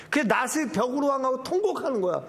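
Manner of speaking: normal talking voice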